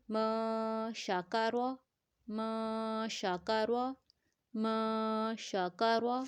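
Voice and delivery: "م" hummed long into "شا کا روا"; held nasal ikhfa. Shown as a demonstration. Teacher models noon before sheen, held nasal sound. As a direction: hold "م" in the nose before each "شا کا روا". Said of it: "تو ہم پڑھیں گے".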